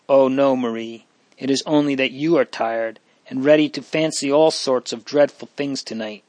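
usual speaking voice

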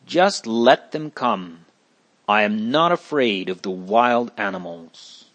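plain reading voice

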